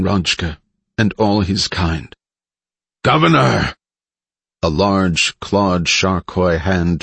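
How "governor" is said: higher, louder desperate cry; shouting. Acted. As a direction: call out to her urgently. Desperate call to the governor.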